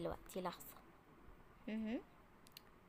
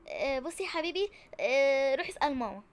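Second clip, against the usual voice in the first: flustered outburst; 2 semitones higher, 8 dB louder than usual. Acted. Doctor caught not knowing.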